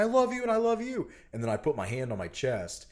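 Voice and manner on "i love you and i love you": high, loud declaration to each person; retold scream. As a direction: call out love in a high re-enacted yell. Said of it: "I just screamed dead in her face".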